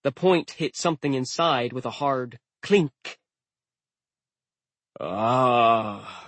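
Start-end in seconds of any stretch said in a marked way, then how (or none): 5.0-6.2 smug dying sigh; smug death gasp. A narrator's reading. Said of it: "death gasp sounded almost smug".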